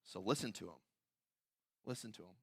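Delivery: low, hushed closing appeal; the Father's command. Acted. God the Father pointing listeners to his Son, hushed. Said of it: "God the Father says".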